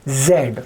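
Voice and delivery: "जेड" starting with a buzzing z; "जेड" with z, never j; correct English Zed. Shown as a demonstration. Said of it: "ऐसे बोलना पड़ेगा इसको".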